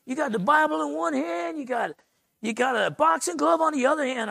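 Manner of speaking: light up with admiration, high and bright-eyed; lit-up Texan buddy. Retold or imitated. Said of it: "he starts lighting up and saying".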